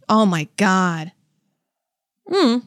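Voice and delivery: usual speaking voice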